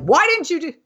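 high-pitched mock scold at the kids; mock mad mom. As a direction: squeak it out like an exasperated mother. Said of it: "when I'm mad at them and I'm like".

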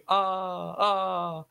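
a fading, echoed Ah, ah; mock reverb on a yell; mock echoing yell. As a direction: imitate a cheesy echo effect. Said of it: "har de på någon ekoeffekt".

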